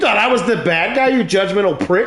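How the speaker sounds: high-pitched, laughing outrage; mock indignation. Comedian scolds the judging crowd, mock indignant. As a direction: turn on the crowd, playfully affronted and high.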